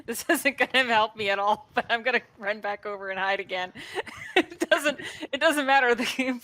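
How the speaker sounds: laughing through the turn; giggling while announcing the move. Real, not acted. Player laughs while hiding from the ogre, laughing.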